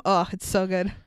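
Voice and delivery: ordinary talk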